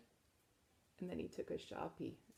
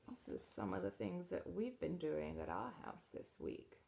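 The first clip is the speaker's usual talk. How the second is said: plain reading voice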